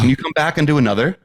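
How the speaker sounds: lightly voiced apologetic doctor; imitating awkward doctor. Acts out doctor's awkward request.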